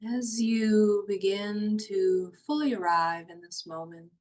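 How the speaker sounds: hushed guiding voice for a body-awareness meditation; guided meditation. Acted. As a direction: ease them down into calm, slowly.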